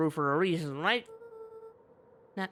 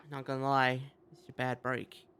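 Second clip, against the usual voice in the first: ordinary delivery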